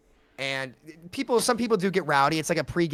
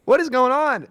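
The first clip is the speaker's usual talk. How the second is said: incredulous; 8 semitones higher, 4 dB louder than usual. Retold or imitated. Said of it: "I was like".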